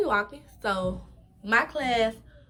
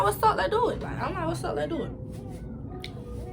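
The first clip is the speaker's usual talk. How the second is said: taunting challenge; 8 semitones higher, slower than usual. Retold or imitated. Challenging girl to fight.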